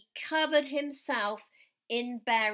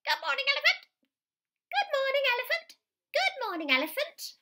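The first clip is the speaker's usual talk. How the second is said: squeaky, much higher and louder greetings; high animal voices; 4 dB louder, much faster than usual. A narrator's reading. The passing animals greeting the berry-covered elephant, high and squeaky. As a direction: pipe up like little creatures. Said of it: "And they said".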